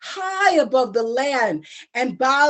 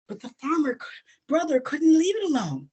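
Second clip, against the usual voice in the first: ordinary delivery, nothing marked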